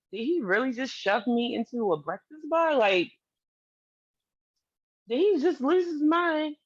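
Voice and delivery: can't-believe-it inner voice; retold disbelief. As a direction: replay the stunned reaction, voice lifting. Stunned reaction to being shoved.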